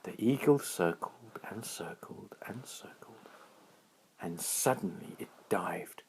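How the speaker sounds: whispered storytelling; quiet storyteller voice; hushed narration. A narrator's reading. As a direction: build suspense in a whisper.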